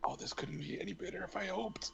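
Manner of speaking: fast gleeful whisper. Real, not acted. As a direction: whisper it with glee. Gleeful aside to table.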